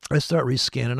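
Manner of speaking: ordinary delivery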